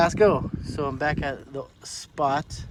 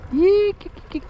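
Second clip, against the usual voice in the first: fish call; 5 dB louder than usual. Real, not acted. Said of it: "I forgot to call for 'em".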